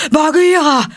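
high, loud call of a bear; loud breathless cry; panting shout. A narrator's reading. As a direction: Baloo bellowing his friend's name. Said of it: "schrie er keuchend".

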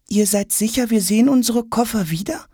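put-on high child voice, lightly doubtful; suspicious child. A narrator's reading. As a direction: ask warily, not quite trusting. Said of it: "fragt Jonas misstrauisch".

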